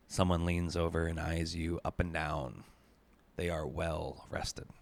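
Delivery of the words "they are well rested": ordinary delivery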